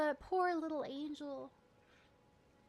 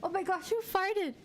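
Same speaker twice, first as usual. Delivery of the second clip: laughing outburst; 6 semitones higher than usual. Real, not acted. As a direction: laugh it out in disbelief. Laughs at a game sound.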